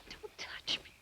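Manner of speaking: whispered, very soft warning; barely voiced refusal; hushed rebuff. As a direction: push him away in a whisper. Rebuffs his advance.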